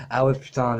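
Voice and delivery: normal talking voice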